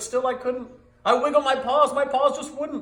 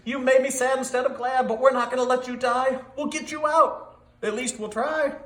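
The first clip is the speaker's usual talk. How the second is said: higher, louder pleading call; urgent cry; 6 semitones higher, 5 dB louder than usual. A narrator's reading. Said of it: "But Sam cried".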